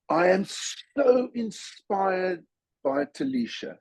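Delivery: ordinary talk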